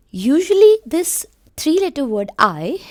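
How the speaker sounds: drawn-out, loud and high for emphasis; teacher stressing the strong letter I; emphatic slow teaching. Shown as a demonstration. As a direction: stress the point slowly and loudly. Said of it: "most dominant sound".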